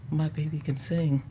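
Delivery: voiced proud mother, high and soft; mother's hushed amazement. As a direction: imitate a mom quietly marveling at her child. Voices mom overhearing her sing.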